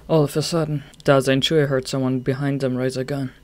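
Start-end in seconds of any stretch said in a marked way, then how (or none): none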